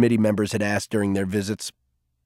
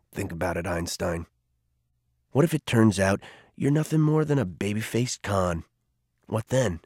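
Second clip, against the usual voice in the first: put-on much lower and softer, wry older man; Garvey's dry low voice; 4 semitones lower, 6 dB quieter than usual. A narrator's reading. Garvey teasing Cole about his attitude, low and wry. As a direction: tease him gently, dry and unhurried.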